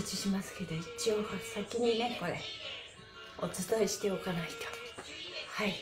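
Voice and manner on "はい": usual speaking voice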